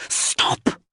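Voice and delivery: urgent voiceless hiss; a sharp breathy hiss, no voice at all. A narrator's reading. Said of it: "she hissed".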